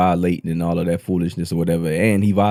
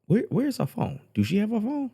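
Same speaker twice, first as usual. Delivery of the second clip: high alarmed question; 13 semitones higher, 6 dB quieter than usual. Real, not acted. Listener urgently asks about phone.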